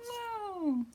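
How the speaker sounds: high amazed whoa; mock-amazed whoa for kids; surprised whoa. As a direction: gasp it out in wonder. Surprise in picture book.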